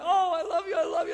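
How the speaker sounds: high, loud, half-shouted I love you; loud exasperated love. Pretend. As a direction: hug turning into a scolding. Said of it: "And then comes the anger".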